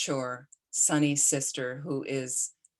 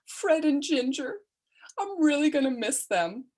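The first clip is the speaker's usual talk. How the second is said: put-on a wistful, tearful goodbye to old films; tearful dying brother; 11 semitones higher than usual. Dying brother crying over old movie.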